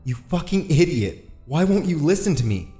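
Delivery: high, frustrated outburst; beside himself. A narrator's reading. Eddie rails at the cop for not listening, beside himself. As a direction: rail at someone who will not listen. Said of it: "Eddie was beside himself".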